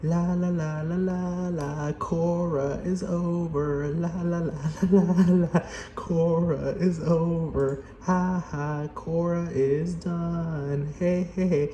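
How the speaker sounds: high squeaky gleeful fans, sing-song la la; mocking cheering fans. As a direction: cheer like giddy fans celebrating. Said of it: "everyone in the comments will be like".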